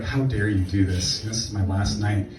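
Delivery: soft, low mock guilt trip; mild mock wounded plea. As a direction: lay on the guilt quietly. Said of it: "I give him this guilt trip".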